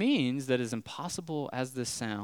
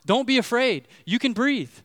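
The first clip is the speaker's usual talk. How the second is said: high, loud mock yell of a guide; yelling in broken English; 10 semitones higher, 9 dB louder than usual. Snorkel guide yelling at the scared pastor, high and loud. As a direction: shout it up high at a panicking tourist. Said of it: "he's just yelling at me in broken English".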